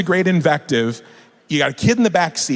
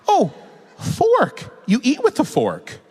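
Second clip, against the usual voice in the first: high, playful sing-song of feigned understanding; innocently understanding parent; 11 semitones higher than usual. Acted. Parent pretending the child said something harmless, innocently. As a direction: explain it sweetly as if to a toddler.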